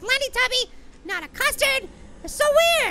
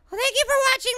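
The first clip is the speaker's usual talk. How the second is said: usual speaking voice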